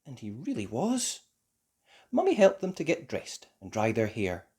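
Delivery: ordinary delivery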